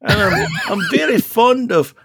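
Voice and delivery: put-on Mancunian accent. Playing a Mancunian character.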